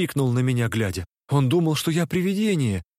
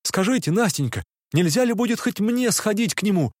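higher, urgent; eager plea; 6 semitones higher than usual. A narrator's reading. Dreamer urgently offers Nastenka to go to him himself.

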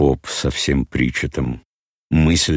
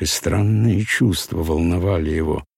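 ordinary delivery, nothing marked

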